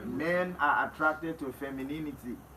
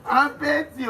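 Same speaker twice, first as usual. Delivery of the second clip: laughing; 9 semitones higher, 4 dB louder, much slower than usual. Real, not acted. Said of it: "I'm very petty".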